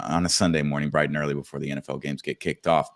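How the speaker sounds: usual speaking voice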